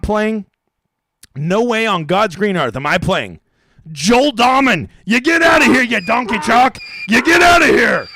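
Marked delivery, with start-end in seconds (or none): 3.9-8.1 louder and higher, hammed-up shout; mock outburst. Banishing a chalky golfer, a hammed-up higher shout. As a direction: yell him out of the lineup.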